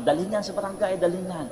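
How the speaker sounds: high, insistent retold order; imitated angry demand. Angry child wants the thief taken away, imitated high demand. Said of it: "alam mo yung galit na galit".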